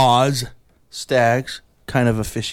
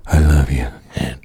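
deep emotional whisper; 13 semitones lower than usual. Retold or imitated. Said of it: "You're like".

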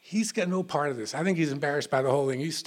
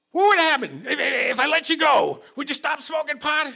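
high, loud menacing demand; freaked-out cop yelling; 10 semitones higher, 5 dB louder than usual. Retold or imitated. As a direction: shout it like a cop gone crazy. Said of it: "he's freaking me out".

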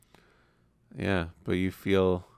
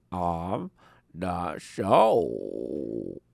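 troll voice; much slower than usual. Acted. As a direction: stretch each word like a clumsy troll. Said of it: "best troll voice".

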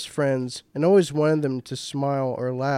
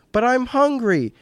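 put-on high-pitched little-kid whine; plaintive, much higher childish complaint; whiny protest; 8 semitones higher than usual. A narrator's reading. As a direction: whine it like a hungry four-year-old. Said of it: "protested with a whine".

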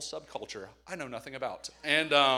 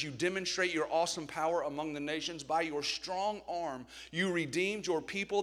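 louder, declaiming a psalm to the room; scripture reading; 4 dB louder than usual. Acted. Preacher reading a psalm aloud to his church, declaiming. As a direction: proclaim the psalm with weight.

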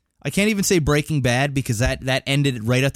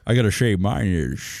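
Sean Connery voice; 5 semitones lower than usual. Acted. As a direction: spit it out like Connery. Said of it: "you're not doing a Connery".

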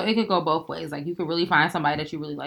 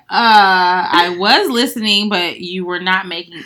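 defensive retort; 9 dB louder, slower than usual. Retold or imitated. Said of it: "I'd be like".

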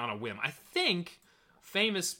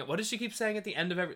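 ordinary delivery

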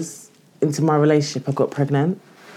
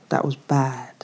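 sighed whisper; 7 dB quieter, slower than usual. Real, not acted. Sighing at painful memory.

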